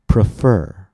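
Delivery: "prefer" slow, weight on the second syllable; light prefix, long strong root in "prefer"; stress on the root. Shown as a demonstration. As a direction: model "prefer", stressed at the back. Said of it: "we stress the root".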